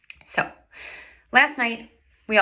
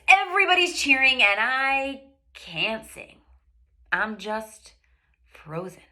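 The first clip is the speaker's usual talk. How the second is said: ordinary delivery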